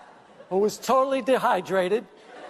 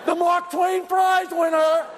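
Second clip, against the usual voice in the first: high, drawn-out boastful holler; imitating a show-off yelling for attention; mock yelling; 7 semitones higher, slower than usual. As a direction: announce yourself to the whole lobby. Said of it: "started yelling".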